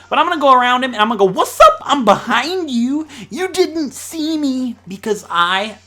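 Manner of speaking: playful taunt. Pretend. Player sneaking behind the chef, gloating loudly. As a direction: crow it high and stretch the words.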